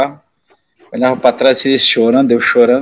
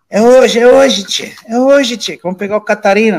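retold pitched-up hazing taunt; taunting threat; 8 semitones higher than usual. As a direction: gleefully warn the rookie he's next. Older players taunting before hazing.

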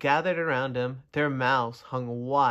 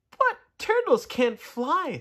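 high, slow and insistent; a small bird correcting gently; 12 semitones higher, 3 dB louder, slower than usual. Acted. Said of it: "said the bird".

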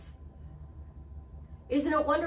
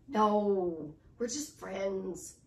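low drawn-out no, trailing softer and slower; downplaying denial; 5 semitones lower, 4 dB quieter, much slower than usual. Answering whether that's the boyfriend, a low, deflated denial. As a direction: brush it off, slow and low.

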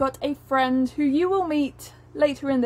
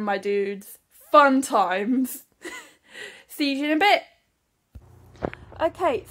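ordinary talk, nothing marked

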